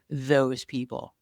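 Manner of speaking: slow, set-apart THOSE PEOPLE; air-quoted. Retold or imitated. Quoting a dismissive tribe member on "those people", air-quoted. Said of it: "air quote".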